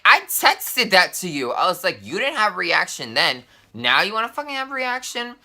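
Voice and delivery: high, heated retort climbing to disbelief; indignant argument. Retold or imitated. Teller argues with friend on the phone, indignant.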